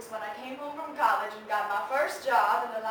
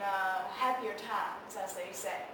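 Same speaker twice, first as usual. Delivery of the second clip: ordinary talk, nothing marked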